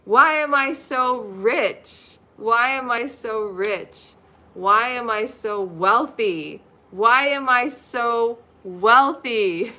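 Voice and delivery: very slow, loud proclamation; emphatic affirmation. Shown as a demonstration. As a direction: chant it slowly and proudly. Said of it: "repeat after me".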